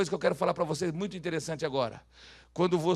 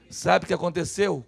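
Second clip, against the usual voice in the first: usual speaking voice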